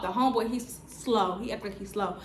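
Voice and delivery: ordinary talk, nothing marked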